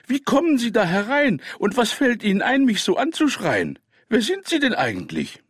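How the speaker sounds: high-pitched, loud outraged scolding; indignant. A narrator's reading. Grandmother confronting the armed stranger, indignant. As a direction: scold the intruder in a high, affronted voice. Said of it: "rief sie entrüstet".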